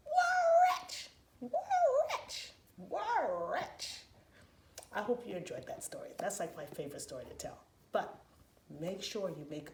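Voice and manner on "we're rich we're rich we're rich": very high, loud squeal of joy; thrilled mom. Acted. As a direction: shriek with delight.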